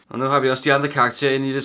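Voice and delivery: ordinary delivery, nothing marked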